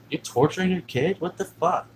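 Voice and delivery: retold indignant questioning; outraged confrontation. Stepmother accusing dad of torturing kid, retold outrage.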